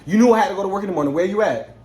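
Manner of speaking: frantic yell about getting to work in the morning; retold yelling. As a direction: yell it down the phone, building. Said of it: "I'm over here yelling".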